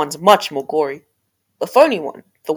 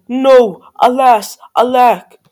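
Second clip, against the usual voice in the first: loud, higher, hammed-up lament; dramatic cry; 5 semitones higher, 10 dB louder, much slower than usual. A narrator's reading. Prince losing Cindy at midnight, crying out loudly. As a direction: cry out each word as a separate exclamation. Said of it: "The prince cried".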